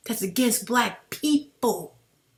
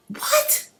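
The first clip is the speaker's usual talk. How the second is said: much higher, incredulous; exasperated what. Real, not acted. Retelling his reaction to the debate, exasperated. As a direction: throw it out in disbelief. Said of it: "y'all see me getting angry".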